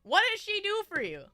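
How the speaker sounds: ordinary talk, nothing marked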